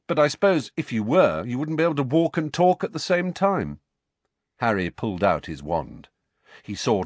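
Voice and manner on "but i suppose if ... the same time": higher-pitched needling; teasing jibe with raised pitch; mocking taunt. A narrator's reading. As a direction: goad him with mock reasoning. Harry mocking Dudley's stupidity.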